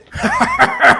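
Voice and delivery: high, loud rowdy cackle from a recording; drunk man's laugh. Acted. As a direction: burst out cackling like a drunk reveller. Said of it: "you hear a man say".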